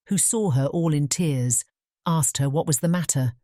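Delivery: plain reading voice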